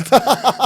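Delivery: sharp high laugh burst; can't hold the laugh in. Real, not acted. Laughs at own joke.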